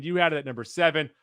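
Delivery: usual speaking voice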